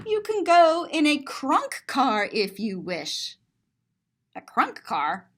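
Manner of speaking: ordinary delivery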